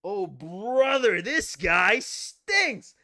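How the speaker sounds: loud, high, very drawn-out heckle; loud cartoonish jeer, milking the last word; hammed-up mock disgust. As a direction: stretch every word in a big mock complaint. Quoted catchphrase, hammed up.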